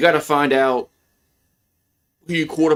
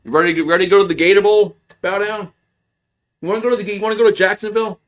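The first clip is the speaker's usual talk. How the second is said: fast, raised taunting jeer at the rival team; mocking taunt; 6 semitones higher, 3 dB louder, much faster than usual. Real, not acted. Fan taunting rival team's bowl hopes, mocking jeer. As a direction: rub it in, quick and high.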